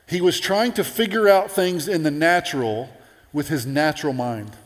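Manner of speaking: usual speaking voice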